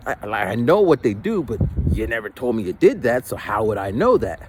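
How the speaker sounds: ordinary talk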